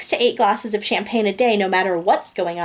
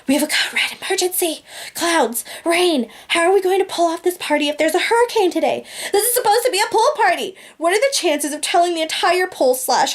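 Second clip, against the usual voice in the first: high-pitched, frantic pleading; panicked pleading; 6 semitones higher than usual. Acted. Teen pleading with her nanny in high-pitched panic. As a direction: fret in a high, rushed panic.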